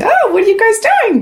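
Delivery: high, fast, teasing little-sister voice; high quick little sister calling after them; nosy little sister. Retold or imitated. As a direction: squeal it like the kid sister peeking in. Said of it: "the little sister that's going".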